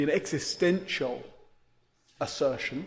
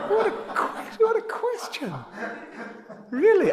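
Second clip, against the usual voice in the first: high laughing disbelief; 3 dB louder than usual. Real, not acted. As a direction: laugh it out in amused surprise. Laughing at a question.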